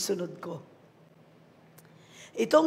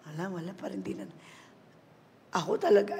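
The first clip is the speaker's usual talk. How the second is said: ordinary talk, nothing marked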